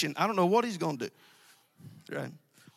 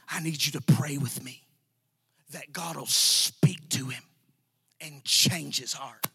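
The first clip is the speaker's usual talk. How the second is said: imitated woman pleading; 5 semitones lower, slower than usual. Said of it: "She said".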